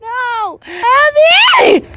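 high scream for a lost friend; wail, rising to a loud shriek; dramatic high-pitched cry; anguished yell. Real, not acted.

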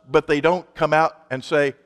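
ordinary talk, nothing marked

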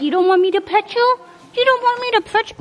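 high, quick mock hurt; mock offended. Real, not acted. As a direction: pretend to be snubbed by the horse.

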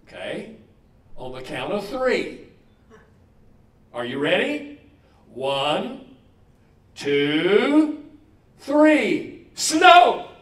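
put-on small boy's countdown swelling into a shriek; countdown building to shout. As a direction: count down like a boy summoning snow, then explode. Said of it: "he says".

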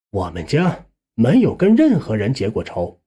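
usual speaking voice

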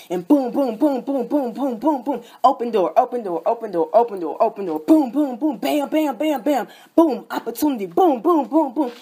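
loud rhythmic chanting of boom; pounding sermon cadence; fired-up preaching. Acted. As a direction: preach it building like a rally.

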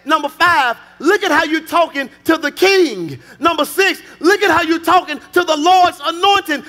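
high, loud, building to an outraged shout; a counted tirade, climbing in pitch to the end; full-force preaching. Acted. Offended king rebuking his wife, full-force preaching.

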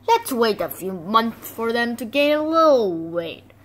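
normal talking voice

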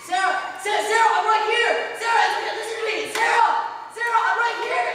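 desperate shouting. Acted. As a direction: shout to be heard by someone who can't. Ghost shouting, wife can't hear.

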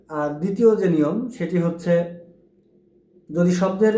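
ordinary talk, nothing marked

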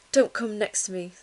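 lightly voiced prim snub; a hint of a prim doll. A narrator's reading. Prim doll shunning Ruby, prim snub. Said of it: "said a prim doll".

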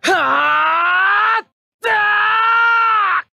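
very long, high sustained yell; all-out battle cry. Acted. Pilot in an all-out attack, long screaming cry. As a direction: let the scream build and hold it.

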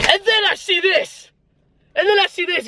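high, exasperated showing; mock outrage. Real, not acted. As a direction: act fed up with the universe. Mock outrage at car sign.

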